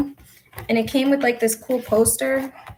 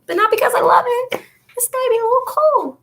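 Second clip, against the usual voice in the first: high-pitched protest; 12 semitones higher than usual. Real, not acted. Woman insisting she cleans but not for love, high-pitched protest. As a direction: voice climbing high.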